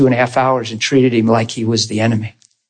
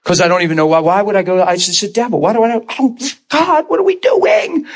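increasingly emotional; 6 semitones higher than usual. Retold or imitated. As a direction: pitch climbing into a mock wail. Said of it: "the more emotional I'm getting".

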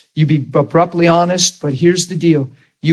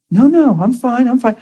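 higher, hasty no-no-I'm-fine; a higher voice hurrying to reassure; caller brushing it off; 8 semitones higher than usual. Retold or imitated. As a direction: protest quickly that all is well. Caller insists they're fine.

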